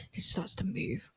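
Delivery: hushed eerie whisper; spooky whisper. Acted. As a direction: whisper like a ghost story.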